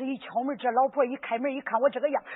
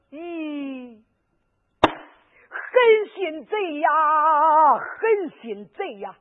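slow, crying lament over the robbers; old woman weeps for the robbed young man; tearful lament. Acted. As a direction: wail over the cruel thieves.

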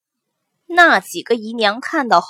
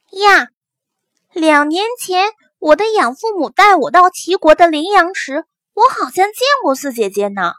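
higher girl voice, mock astonished; feigned surprise; 7 semitones higher than usual. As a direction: act innocently startled, young lady. Heroine feigning sudden recall.

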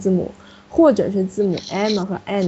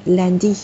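landy with its last vowel stressed in a falling fourth tone; stress on final vowel. Shown as a demonstration. Teacher confirms student's word, final-syllable stress. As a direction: put the weight on the "dy" of landy. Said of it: "所谓重音就是读四声".

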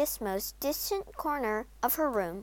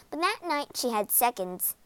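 ordinary delivery

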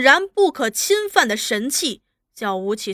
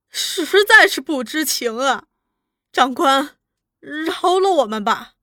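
pleading; 3 semitones higher, 4 dB louder than usual. A narrator's reading. Mother begging officer to let family go, pleading. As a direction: implore the officer for mercy.

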